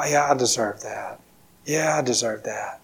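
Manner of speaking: soft, low acted resignation; resigned self-blame. Believers telling themselves they earned punishment, quietly resigned. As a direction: murmur it low, as if accepting the blame.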